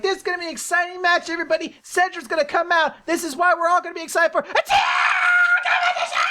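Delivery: high, fast announcer gushing; manic host hype. Acted. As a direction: build from breathless hype to a shriek. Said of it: "she gotta be at the level of".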